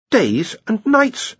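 slow, high awed realisation; dawning wonder. A narrator's reading. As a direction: breathe it out in wonder. Ponder realising.

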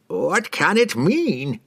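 slow, high, anguished cry; desperate old man. A narrator's reading. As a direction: cry out in despair. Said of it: "he cried desperately".

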